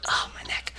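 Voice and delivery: ordinary talk, nothing marked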